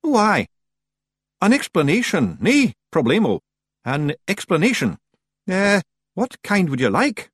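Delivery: high Scots voice, playing for time; high-pitched, hedging Feagle voice; uneasy stalling. A narrator's reading. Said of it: "shifting uneasily".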